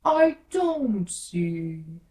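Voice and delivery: very slow, loud and flat-out curt; slow, loud caterpillar drawl. A narrator's reading. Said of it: "said the caterpillar".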